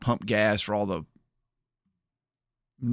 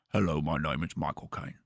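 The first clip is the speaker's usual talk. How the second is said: lower, softer attempt at a Michael Caine voice; a shaky, lowered stab at Michael Caine; failed celebrity impression, a little lower; 5 semitones lower, 5 dB quieter than usual. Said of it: "I can't do it".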